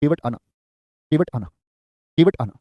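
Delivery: "केवट आना" on its own, low and falling, repeated; separate falling phrase. Shown as a demonstration. Teacher dictating the second half to students, "केवट आना" falling. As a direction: say "केवट आना" apart, dropping to the end. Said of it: "लेकिन केवट आएगा".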